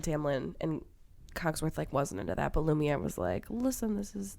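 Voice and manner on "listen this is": a clumsy mock French accent as a suave candlestick; hammed-up, terrible French accent, pitched higher; bad French accent. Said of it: "that was a terrible French accent".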